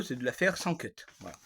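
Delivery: ordinary talk, nothing marked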